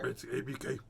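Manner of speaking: low, soft whispered aside. Acted. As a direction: breathe it out like a secret.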